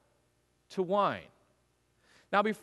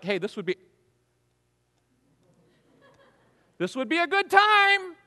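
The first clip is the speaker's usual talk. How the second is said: high, louder coaxing hint to her son; a mother prodding her grown son, pitched up; nudging mother; 9 semitones higher, 5 dB louder than usual. Retold or imitated. Mary nudging her son Jesus about the wine, coaxing and pitched up.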